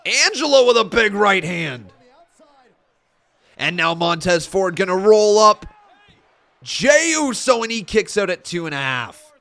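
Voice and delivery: loud live ringside calling; play-by-play. Acted. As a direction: call the action as it happens.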